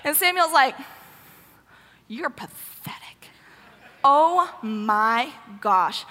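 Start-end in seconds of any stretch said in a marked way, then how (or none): 2.1-6.0 hushed, drawn-out scorn, then exasperated drawl; slow, withering mock disgust; disgusted contempt. Samuel despising a whimpering Saul, slow disgusted contempt. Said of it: "he's livid".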